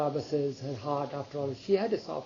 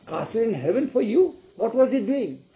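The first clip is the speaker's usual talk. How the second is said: higher, louder echo of a claim; scoffing surprise, voice lifted; incredulous questioning; 6 semitones higher, 5 dB louder than usual. Acted. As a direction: question it in acted disbelief. King incredulously questions his wife.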